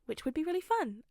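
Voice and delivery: ordinary delivery, nothing marked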